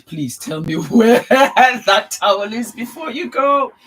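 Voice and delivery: loud, high, laughing plea; laughing request. Real, not acted. Vlogger asking for the towel, loud and laughing. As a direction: ask while laughing.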